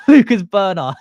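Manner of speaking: loud, high burst of laughter; real helpless laughing fit; laughing hard. Real, not acted. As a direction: crack up loudly around the name. Cracking up at a name.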